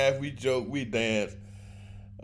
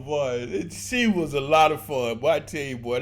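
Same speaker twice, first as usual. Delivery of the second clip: usual speaking voice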